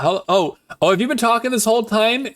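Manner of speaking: ordinary delivery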